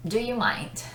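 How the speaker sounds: ordinary talk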